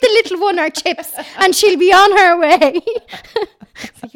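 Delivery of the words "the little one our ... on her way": high, loud Tipperary voice through laughter; laughing Tipperary accent. Retold or imitated. Joanne defending her friend at the chipper in a Tipperary accent. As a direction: shoo the crowd aside in Tipperary, laughing. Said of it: "she's like".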